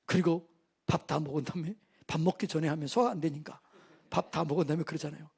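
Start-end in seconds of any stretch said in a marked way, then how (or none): none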